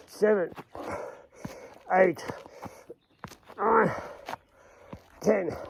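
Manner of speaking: counting through the strain of crunches; high, strained counting mid-rep; doing sit-ups. Real, not acted.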